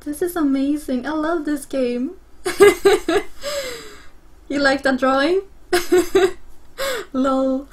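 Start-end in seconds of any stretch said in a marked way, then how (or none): 2.4-4.1 high, running giggle; happy laughing fit. Real, not acted. Player to viewers who like the drawing, giggling happily. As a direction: burst into real, delighted laughter.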